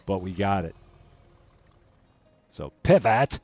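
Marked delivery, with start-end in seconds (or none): 2.8-3.4 loud comic yelp; bad David Schwimmer. Streamer steering in a game, bad sitcom impersonation. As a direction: bark the word like a sitcom actor. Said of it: "David Schwimmer impersonation".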